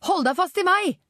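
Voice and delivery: high-pitched warning shout; shouting over engine. A narrator's reading. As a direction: call over a puttering scooter. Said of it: "ropte hun over putringen fra scooteren".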